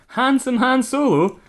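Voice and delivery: high, slow swooning gasp of the name; impressed cry. Acted. Samantha swooning over Han Solo, high and slow. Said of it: "cried Samantha, impressed".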